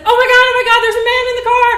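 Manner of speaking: very high, loud panicked scream, rushed fast. Retold or imitated. Woman finds a man in the car, terrified scream. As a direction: shriek in terror, words tumbling. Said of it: "I screamed".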